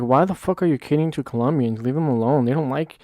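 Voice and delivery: ordinary talk, nothing marked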